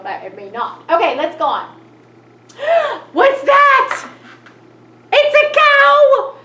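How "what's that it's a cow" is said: high, loud gasp of delight; mock excited discovery. Teacher shows kids the cow with big surprise. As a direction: act thrilled at spotting the cow.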